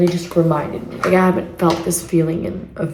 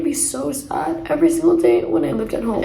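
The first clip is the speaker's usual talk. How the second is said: tearful; 7 semitones higher than usual. Real, not acted. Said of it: "reminding me of how I used to cry".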